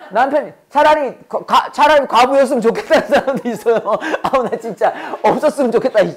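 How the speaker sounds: amused, talking through laughter; giggly, half-laughing delivery. Real, not acted. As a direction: break into laughs while telling the joke. Joking about wishing husbands away.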